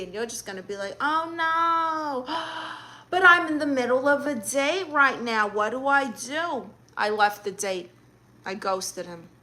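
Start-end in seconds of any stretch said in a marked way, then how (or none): 1.0-6.7 high-pitched, hammed-up distress with a gasp; put-on dismay for a staged call; fake emergency panic. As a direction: act alarmed by a made-up emergency. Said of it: "act like there's an emergency".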